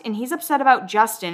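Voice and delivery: usual speaking voice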